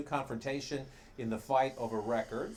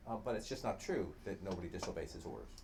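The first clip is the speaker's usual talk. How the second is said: ordinary delivery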